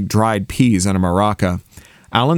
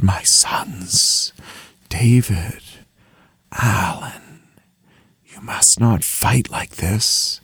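breathy half-whisper, spaced out with pauses; a father's voice drifting on the wind; elusive ghostly voice; 2 semitones lower, 3 dB quieter, much slower than usual. A narrator's reading. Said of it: "elusive as a face in the clouds".

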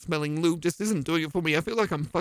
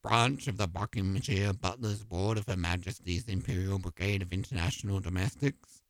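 deep stuffy doorman; 10 semitones lower, 6 dB quieter than usual. Acted. Doorman voice, very low.

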